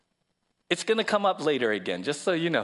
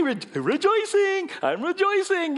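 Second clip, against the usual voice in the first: goofy caricature; 12 semitones higher, 3 dB louder, slower than usual. Retold or imitated. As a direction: lampoon the oblivious happy believer, pitched way up and stretched. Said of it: "goofy caricature".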